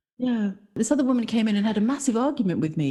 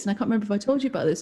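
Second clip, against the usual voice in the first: usual speaking voice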